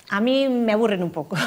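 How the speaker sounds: said through a real laugh. Real, not acted. Author tells host, laughing, that easy love stories bore her. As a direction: laugh it out as you confess.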